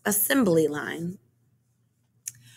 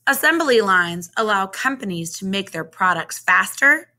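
ordinary delivery, nothing marked

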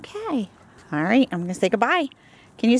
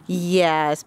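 ordinary talk

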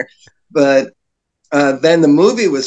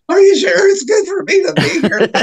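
much higher, nasal-free nerd whine; nerdiest voice; 12 semitones higher than usual. Retold or imitated. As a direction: squeak it out like a timid nerd. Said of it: "in my nerdiest voice".

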